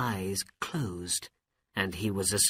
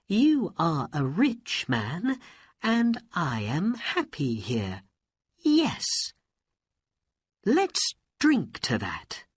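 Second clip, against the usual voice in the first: princess voice; 7 semitones higher than usual. Acted. Princess feigns agreement.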